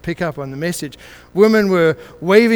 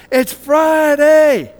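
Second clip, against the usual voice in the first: slow, drawn-out shout, much higher and louder; top of lungs yell; 9 semitones higher, 8 dB louder, much slower than usual. Retold or imitated. Preacher yelling the final line to the congregation, drawn-out shout. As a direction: belt it to the back of the church. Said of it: "yelled out at the top of his lungs".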